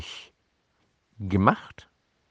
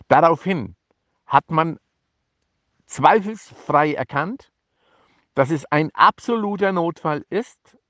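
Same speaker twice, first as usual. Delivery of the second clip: ordinary talk, nothing marked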